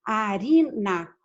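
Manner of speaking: "arina" said slowly, RI held, NA set apart; stressed RI in arina. Shown as a demonstration. Teacher repeats "arina" so students hear the tonic syllable, slow stressed RI. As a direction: sound out "arina" syllable by syllable, weight on RI. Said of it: "Onde é que se encontra a sílaba tônica?".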